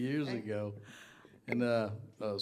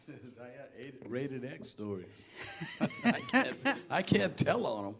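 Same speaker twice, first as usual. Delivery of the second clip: laughing; 4 semitones higher than usual. Real, not acted.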